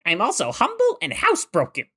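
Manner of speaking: high-pitched cartoon cat voice; Meowth. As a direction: brag in a high, put-on cat voice. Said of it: "Meowth, uh, he's the feline mastermind".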